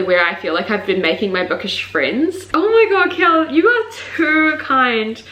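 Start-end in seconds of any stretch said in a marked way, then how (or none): 2.5-5.2 high, slowed thanks; overwhelmed by kindness; touched gush. Real, not acted. Thanking Kiala for her gift, a high touched gush.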